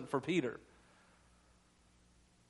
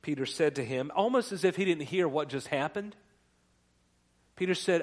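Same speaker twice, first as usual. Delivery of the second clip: usual speaking voice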